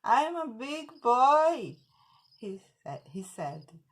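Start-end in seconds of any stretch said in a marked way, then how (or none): 0.0-1.8 loud, slow, higher boast; boastful boy. Acted. The boy laughs at the little bee, boastful. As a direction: puff up the words, big and drawn out.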